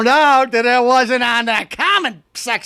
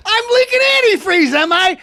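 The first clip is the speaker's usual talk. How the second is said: mock disbelief; 13 semitones higher than usual. Customer mocks the mechanic's verdict, incredulous cry. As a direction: throw the diagnosis back in mock shock.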